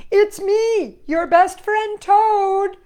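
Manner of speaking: high, loud and slow calling. A narrator's reading. Toad calling across the river to Frog, loud and slow. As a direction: stretch it out to reach far. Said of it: "he shouted".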